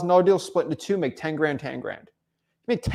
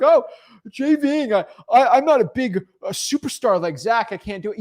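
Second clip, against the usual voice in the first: higher whiny self-pitying mock voice; mock whiny doubter; 5 semitones higher, 4 dB louder than usual. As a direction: whine it like someone making excuses. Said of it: "before you roll your eyes like".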